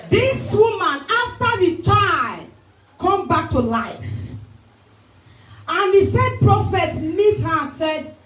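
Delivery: high, fervent sermon call; preaching at full force. Acted. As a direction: rouse the church to repeat after you. Preaching the story at pitch.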